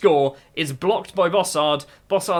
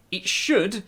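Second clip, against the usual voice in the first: "should" stressed, higher and held before a pause; 4 semitones higher than usual. Real, not acted. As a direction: lean hard on "should", pitched up, then pause. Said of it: "I say that with emphasis on the word should".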